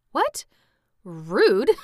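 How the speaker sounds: high squeaked question, then loud verdict; mock offence. Real, not acted. Reader reacting to the dummy insult, mock-offended surprise. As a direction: react aghast, pitch leaping then dropping.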